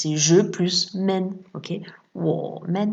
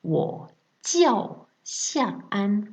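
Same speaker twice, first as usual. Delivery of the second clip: "Wo jiao Xiaoan" very slow and careful; slow model phrase; 4 semitones higher, much slower than usual. Shown as a demonstration. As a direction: model the answer slowly for repeating. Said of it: "répétez après moi".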